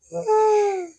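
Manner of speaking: put-on very high anime girl sigh; high girly sigh.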